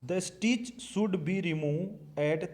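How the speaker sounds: extremely slow, each word spaced; dictating the textbook line to students; slow dictation. Shown as a demonstration. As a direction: read the line aloud at writing speed. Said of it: "last वाली line पढ़िए".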